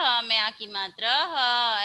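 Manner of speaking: ह में आ की मात्रा ह, each sound drawn out; slow phonics reading. Shown as a demonstration. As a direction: spell it out slowly for the class. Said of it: "ऐसे करके पढ़ना है". (धीरे-धीरे मात्रा जोड़कर पढ़ना)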